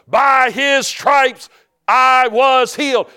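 slow, high, loud declaration. Retold or imitated. Believer answering the devil, loud defiant declaration. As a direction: proclaim it forcefully, word by word. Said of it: "You gotta get louder than the devil".

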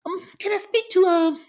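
high, hesitant little voice; mock-meek phone voice; mimicking the other woman. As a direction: ask timidly in a put-on high pitch.

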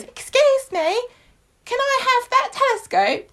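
put-on very high-pitched child voice; little Sam. Child asks a stranger for his telescope, squeaky polite voice. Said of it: "Sam goes".

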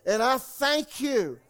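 loud, high, slow declaimed prayer in phrases; led prayer. Real, not acted. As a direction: proclaim it phrase by phrase for a congregation to repeat. Leads congregation in repeat-after-me prayer.

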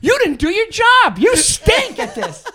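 high, loud, scolding shout; yelled retort. Retold or imitated. Comic telling off the colleague who mocked him, high angry yell. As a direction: tear into him, dragging out the final put-down. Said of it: "similar to what was just yelled".

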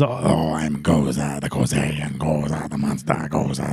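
deep, soft, droning monster voice; Gozer. Retold or imitated. The goddess announcing herself as a voice, ominous drone. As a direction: intone it very low, all on one creepy note. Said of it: "she says".

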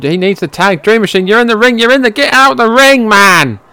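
loud, high shout at the screen; frustrated yelling. Real, not acted. Viewer yelling at Dream Machine to leave the ring, loud and frustrated. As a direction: yell at him to get out of the ring.

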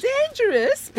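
high incredulous echo; scoffing. Acted. Collector scoffing at Darren's worry, incredulous echo. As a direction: throw the word back in disbelief.

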